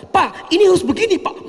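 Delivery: high, fast, insistent pleading to the boss; mock demanding wife; pushy demand. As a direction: rush it out like an impatient employee.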